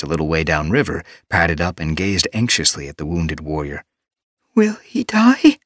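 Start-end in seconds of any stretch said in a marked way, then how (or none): 4.5-5.6 high, slow, frightened question; quavering fear. A narrator's reading. She-cat fearing for the wounded warrior, high and slow. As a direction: ask it slowly, voice thin with fear. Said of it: "Petal's voice quavered".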